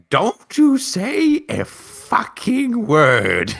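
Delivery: high, loud, drawn-out mock threat; glaring warning. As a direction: stretch every word into a hammed-up warning. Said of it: "I glare at them".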